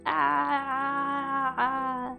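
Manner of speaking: ordinary talk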